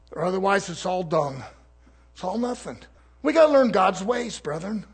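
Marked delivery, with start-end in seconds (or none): none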